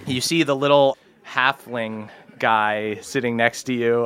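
ordinary talk, nothing marked

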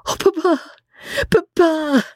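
very high delighted call to her father; Emmy crying out happily to her father; joyful cry. A narrator's reading. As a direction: call out to papa with joy. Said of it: "Emmy cried".

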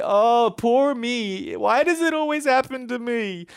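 mock a sulking child feeling sorry for himself; pity tantrum. Preacher mocking a self-pitying complainer, whiny and high. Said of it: "throw a little pity tantrum".

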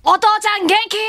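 calling out to dad. Pretend. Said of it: "籠池さんでちょっと呼びかけるか".

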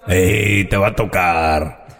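very low, slow rasping demon; raspy devil. Acted. Devil appears to whoever summoned him, deep raspy voice. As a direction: growl it slowly from the depths. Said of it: "Qué voz tan rasposa".

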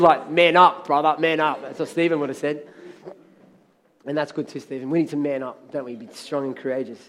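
normal talking voice

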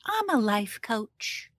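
soft, slow life coach parody; mock life coach voice. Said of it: "high-pitched and breathy voices".

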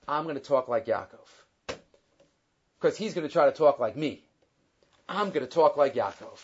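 low, hushed imitation of a soft talker; soft-spoken imitation. Esav plotting to pass as soft-spoken Yaakov, low and soft. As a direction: drop low and soft, lightly playing the part. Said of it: "Yaakov always spoke softly".

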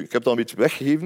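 ordinary delivery

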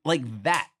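normal talking voice

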